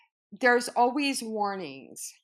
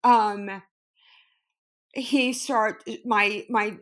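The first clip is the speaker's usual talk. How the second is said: usual speaking voice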